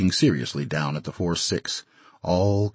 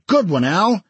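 higher, louder cheering; excited cheer; 12 semitones higher, 5 dB louder than usual. Acted. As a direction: shout it out to a friend. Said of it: "Al had made a Brooklyn hit".

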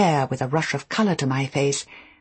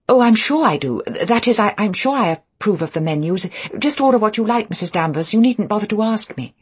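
high, louder, over-eager reassurance; flustered; 7 semitones higher, 5 dB louder than usual. A narrator's reading. Nervous new wife deferring to housekeeper about menus, flustered. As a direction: stumble and gush to get out of deciding.

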